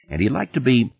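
usual speaking voice

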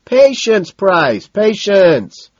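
high, loud admonishment; hollered over the crowd; shout. A narrator's reading. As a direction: shout him into waiting. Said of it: "Madison shouts".

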